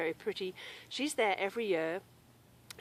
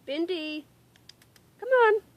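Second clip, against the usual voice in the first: raised, high-pitched call to a pet; calling the dog; 10 semitones higher, 9 dB louder than usual. Real, not acted. Podcaster to dog wandering in long grass, calling her back.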